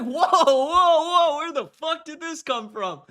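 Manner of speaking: high, stunned whoa then drop; high mock shock. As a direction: recoil in disbelief. Retold shocked reaction.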